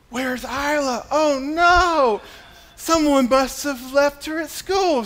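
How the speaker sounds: high, mock-alarmed searching voice; playful mock panic for a giggling four-year-old; silly dad routine. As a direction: pretend you've lost her, hamming it up high. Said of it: "I go through this whole routine".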